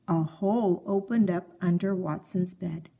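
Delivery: usual speaking voice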